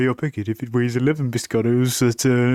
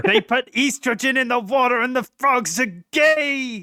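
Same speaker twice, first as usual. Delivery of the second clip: Alex Jones rant; 10 semitones higher than usual. Retold or imitated. Alex Jones impression, high far.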